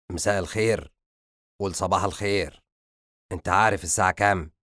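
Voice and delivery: father's sarcastic echo of a greeting; understated stern interrogation; angry. A narrator's reading. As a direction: throw it back with contained anger. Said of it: "اقترب منه شمس بغضب".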